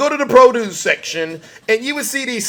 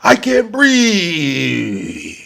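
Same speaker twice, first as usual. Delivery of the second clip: drawn-out, slow whine; whiny anti-masker; much slower than usual. Retold or imitated. Comedian quoting an anti-mask shopper, slow mocking whine. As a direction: stretch it out like a put-upon complainer. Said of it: "This is what they said".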